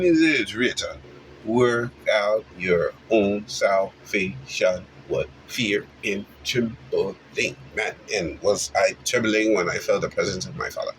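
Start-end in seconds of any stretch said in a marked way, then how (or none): 1.4-7.6 slow, deliberate scripture reading; slow scripture quote. Acted. Quoting the Bible to the listener, slow and weighty. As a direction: lay out each word of the verse slowly.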